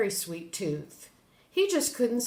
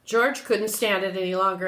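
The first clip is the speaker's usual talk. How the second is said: ordinary delivery, nothing marked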